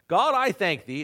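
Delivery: a smug Pharisee praising himself; proud Pharisee. Acted. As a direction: pray as if to your own audience. Voicing the proud Pharisee's prayer.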